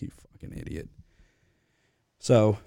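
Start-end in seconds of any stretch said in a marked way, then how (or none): 0.0-0.9 whispered insult under the breath; a voiceless aside to the friend next to you; muttered whisper. Retold or imitated. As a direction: breathe it out so he can't hear. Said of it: "I'm like".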